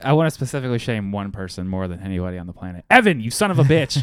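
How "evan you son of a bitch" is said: a touch of hammed-up anger, a bit louder; mock outrage. Host mock-scolding Evan for skipping their own challenge, half-joking anger. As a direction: playfully chew him out.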